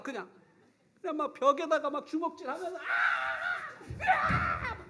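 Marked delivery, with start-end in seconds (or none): 2.8-4.8 frustrated yell. Retold or imitated. Boy punching the wall.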